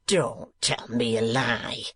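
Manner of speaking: drawn-out menacing schoolmaster; slow, menacing retort. A narrator's reading. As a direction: lean on don't, slowly. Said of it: "retorted the schoolmaster".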